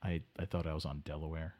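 baffled muttering guest; Delaware Dave. Acted. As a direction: stammer it out, utterly lost. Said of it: "Delaware Dave confused".

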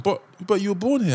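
high-pitched imitated protest; baffled objection, voice jumping up; puzzled questioner. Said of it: "people be like".

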